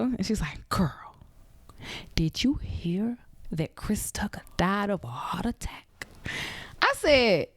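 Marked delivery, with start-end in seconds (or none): 0.7-6.1 hushed gossip whisper. Retold or imitated. Mom whispering gossip to her daughter on the phone, hushed. Mom's gossip call retold.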